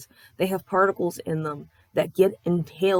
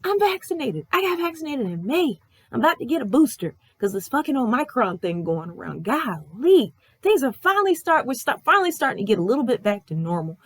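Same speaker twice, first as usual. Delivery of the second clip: mock-shrill, much higher exasperated reply; exasperated retort; 8 semitones higher than usual. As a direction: squeak it out like you're fed up with the question. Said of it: "I'm like".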